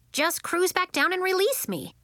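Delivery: put-on happy, carefree boy brushing it off; happily. A narrator's reading. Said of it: "said Tip happily".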